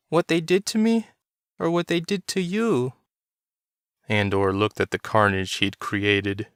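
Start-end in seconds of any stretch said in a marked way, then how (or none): 0.1-2.9 put-on high child's voice, questioning; a small son challenging his father; little boy. A narrator's reading. Child character speaks.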